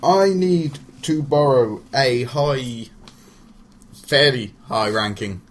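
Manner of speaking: slow, hesitant, drawn out; halting, stretched search for a term; groping for a word. Real, not acted. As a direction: stall while searching the word. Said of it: "I was gonna say".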